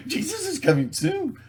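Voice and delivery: high sing-song mock promise; mocking believers, high sing-song. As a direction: chirp it like a naive preacher. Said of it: "That's gonna solve everything".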